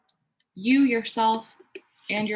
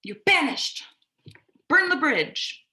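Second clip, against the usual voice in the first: higher, hurt-angry outburst; freaking-out emperor; 7 semitones higher than usual. Retold or imitated. Emperor banishing someone who disagreed, wounded rage. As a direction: lash out and cut them off. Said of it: "the emperor freaks out and contracts and goes".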